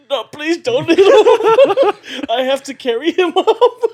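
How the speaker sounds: high, loud laughing plea. Retold or imitated. Begging friend not to hit Raymond, high loud laughing plea. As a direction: beg through laughter.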